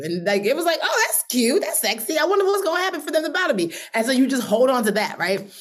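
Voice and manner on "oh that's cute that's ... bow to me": high-pitched, giddy mock delight; delighted reaction. Dreamer reacting to a flattering dream, giddy. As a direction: play the tickled dreamer, pitched way up.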